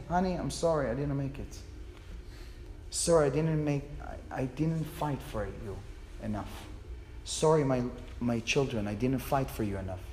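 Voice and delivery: soft, low, halting apology; a husband's farewell to his wife, understated tears; quiet broken-voiced goodbye to his wife and children; mild retold crying. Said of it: "I was crying like, like a baby".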